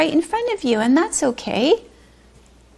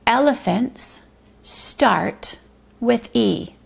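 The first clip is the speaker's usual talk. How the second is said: slow careful reading; slower than usual. Shown as a demonstration. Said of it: "Let's listen very carefully".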